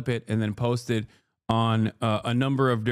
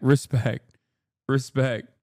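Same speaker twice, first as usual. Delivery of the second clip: ordinary talk, nothing marked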